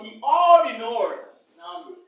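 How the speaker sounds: teacher stressing ordinal slowly; slow careful model. Shown as a demonstration. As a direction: spell it out with the voice for learners. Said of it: "Repeat after me".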